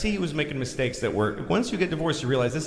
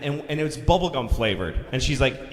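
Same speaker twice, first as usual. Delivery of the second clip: usual speaking voice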